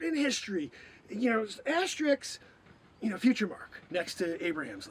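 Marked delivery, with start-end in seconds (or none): none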